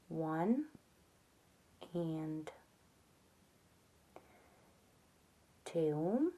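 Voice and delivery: quiet murmured count under the breath; soft counting. Real, not acted. Counting stitches for crochet viewers, softly murmured.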